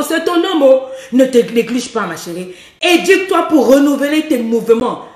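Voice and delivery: usual speaking voice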